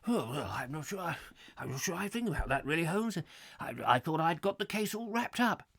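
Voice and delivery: soft, bumbling Watson impression; hesitant, muffled bumbler; Nigel Bruce. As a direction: dither like a baffled old-film sidekick. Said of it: "Nigel Bruce. As".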